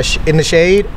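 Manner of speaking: ordinary delivery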